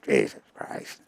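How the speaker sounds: unvoiced muttered curse under his breath; whispered curse. Ted searching the bats, whispered disgusted curse. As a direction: grumble it under your breath while picking bats. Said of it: "And he's going".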